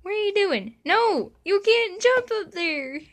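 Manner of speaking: high, loud panicked yell; alarmed shout. Real, not acted. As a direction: stop the cat before it jumps. Cat jumps onto the bed.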